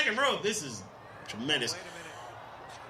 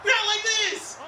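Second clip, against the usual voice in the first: louder and higher, dread building; rising alarm; 8 semitones higher, 3 dB louder, much slower than usual. Real, not acted. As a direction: plead as the move lands. Mounting dread at tombstone.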